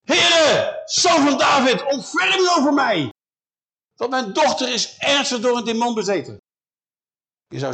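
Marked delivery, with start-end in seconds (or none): 0.1-3.1 high, very loud desperate shout; geschreeuwde smeekbede. Acted. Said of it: "ik denk dat ze geschreeuwd heeft". 3.9-6.4 raised, higher pleading voice; geroepen smeekbede. Acted. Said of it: "ik denk dat ze geschreeuwd heeft".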